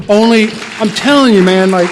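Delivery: high, loud emphatic build; testifying to the meeting, high and loud; fervent testimony. Real, not acted. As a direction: proclaim it to the room.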